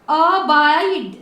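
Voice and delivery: drawn-out, split a-BAAID with no sound after the D; silent final E. Shown as a demonstration. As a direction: stretch अबाइड slowly, ending on the D. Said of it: "उसको silent रखिए". (अबाइड धीरे, खींचकर, आखिरी ई silent)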